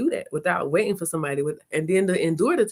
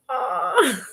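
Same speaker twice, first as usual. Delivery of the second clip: touched aw; 12 semitones higher than usual. Retold or imitated. Touched by son's pride.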